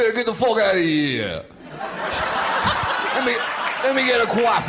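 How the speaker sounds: hammed-up New York tough guy; New York accent. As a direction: bark it like a cabbie from Queens. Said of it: "Let's do a good, like".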